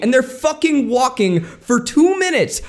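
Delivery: high-pitched incredulous emphasis; exasperated, climbing high; comic exasperation. Real, not acted. Mock-exasperated rant about the montage.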